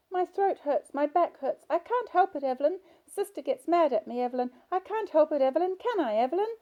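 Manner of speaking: put-on high, loud little-girl voice from an old woman; childish voice. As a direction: play an old patient talking like a small child. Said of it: "The childish voice continued".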